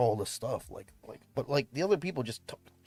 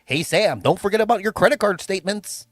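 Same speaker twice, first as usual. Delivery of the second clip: louder, cheery reminder voice; put-on voice; 7 semitones higher, 9 dB louder than usual. Streamer trying a new put-on voice as a joke. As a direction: chirp a friendly reminder. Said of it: "change races".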